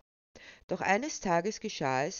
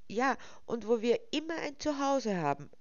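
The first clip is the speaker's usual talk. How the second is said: put-on eager little boy; 7 semitones higher than usual. A narrator's reading. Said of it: "pflichtete Waldo seiner Schwester bei".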